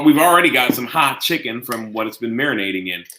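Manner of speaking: ordinary delivery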